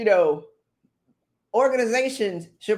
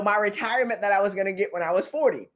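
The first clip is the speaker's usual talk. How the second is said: ordinary delivery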